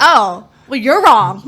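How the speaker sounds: loud, combative retort in imitation; mock yelling back. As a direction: fire back loudly, play-acting. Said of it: "you'll start yelling back".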